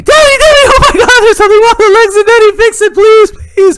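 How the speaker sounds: put-on shrieking high, loud kid plea; frantic child, very high and loud; distraught daughter. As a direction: beg Daddy in a panicked squeal. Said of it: "my daughter comes on to me".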